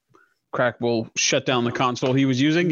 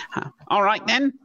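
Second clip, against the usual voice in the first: normal talking voice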